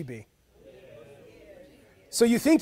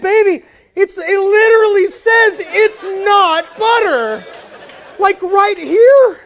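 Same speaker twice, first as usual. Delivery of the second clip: higher, louder, slow insistence with a laugh in it; exasperated insisting; 10 semitones higher, 10 dB louder, slower than usual. Retold or imitated. Husband insisting to his wife about the butter tub, exasperated and loud. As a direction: spell it out, pleading with disbelief.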